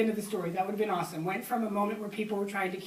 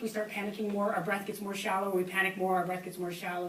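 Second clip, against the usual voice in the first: ordinary talk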